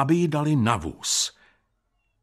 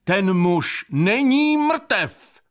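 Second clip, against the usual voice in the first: loud, high, drawn-out proclamation; slow, sonorous shout to a whole crowd; resonant shout; 8 semitones higher, 5 dB louder, much slower than usual. A narrator's reading. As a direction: cry it out broad and ringing. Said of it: "hlasem k neuvěření širokým a zvučným".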